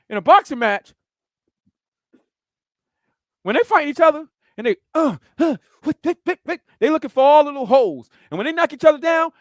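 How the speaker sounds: ordinary talk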